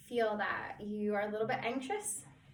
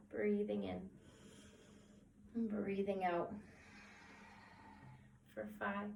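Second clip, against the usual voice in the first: soft, calm countdown with long pauses; guided relaxation; 8 dB quieter than usual. Acted. Counts down through breathing exercise.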